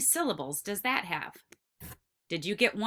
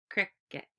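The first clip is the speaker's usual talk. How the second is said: Cricket split in two, softer, with a gap; syllable by syllable; 2 semitones lower, 5 dB quieter than usual. Shown as a demonstration. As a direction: tap out Cricket softly as two separate beats. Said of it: "tap each syllable".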